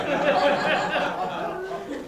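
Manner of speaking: high burst of real laughter. Real, not acted. Teller laughs at own tale.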